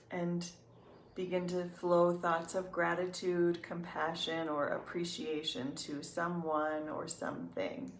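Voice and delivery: soft, unhurried meditation voice with long pauses; calm, even guidance, leaving room to breathe; guided meditation. Real, not acted.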